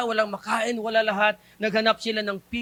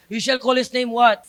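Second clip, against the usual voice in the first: normal talking voice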